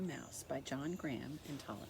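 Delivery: ordinary delivery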